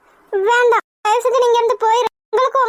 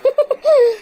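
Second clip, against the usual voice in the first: gloating laugh; 3 semitones higher than usual. Acted. Mother gloats again.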